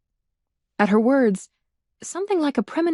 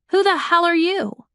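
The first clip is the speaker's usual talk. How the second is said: high, loud angry challenge; indignant demand; 9 semitones higher, 4 dB louder than usual. A narrator's reading. Melissa confronting the painted stranger, angry shout. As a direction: demand it, offended.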